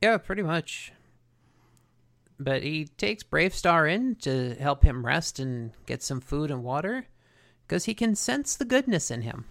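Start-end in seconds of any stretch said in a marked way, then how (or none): none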